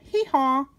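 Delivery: light mock hee-haw; a gentle little bray; donkey bray.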